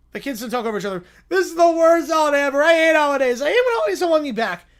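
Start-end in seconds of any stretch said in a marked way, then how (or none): 1.3-4.6 lightly voiced child's outburst; fuming whiny kid. Kid ranting about the worst holiday ever, whiny. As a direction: rant like a kid who hates holidays.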